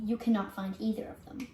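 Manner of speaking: normal talking voice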